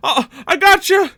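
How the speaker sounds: high, loud gleeful yell; excited shout. Real, not acted. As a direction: crow with triumph. GM cheers an enemy strike.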